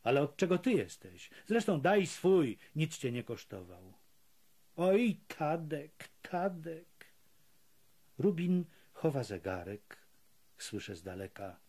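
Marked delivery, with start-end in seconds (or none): 4.8-7.1 slow drawn-out plea to Tadek; pleading call. A narrator's reading. Rubin begging Tadek to hide the watch, slow pleading call. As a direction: stretch it out, begging.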